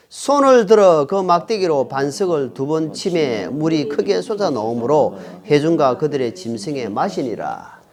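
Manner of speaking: slow, low read-aloud of a Bible verse; scripture reading. Acted. Preacher leads the congregation in reading God's command to Moses, scripture reading. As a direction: read it out slowly and solemnly, phrase by phrase.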